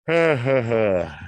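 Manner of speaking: short real laugh; a burst of amused laughter. Real, not acted. Laughs at himself.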